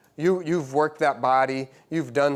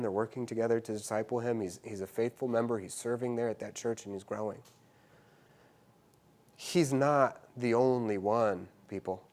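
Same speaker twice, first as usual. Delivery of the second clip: much softer, intimate preaching; hushed sermon; 3 semitones lower, 7 dB quieter than usual. Acted. Preacher softly telling of the seeking lost, hushed. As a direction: draw them in close.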